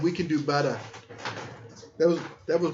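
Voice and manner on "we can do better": mock JFK Boston accent, dropping the R; highbrow JFK accent. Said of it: "J F K Boston accent".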